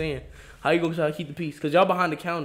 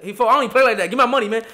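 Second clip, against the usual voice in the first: loud, higher mock outrage; mock angry demand; 7 semitones higher, 10 dB louder, faster than usual. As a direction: bark the demand like a cheated worker. Retold angry worker demanding money.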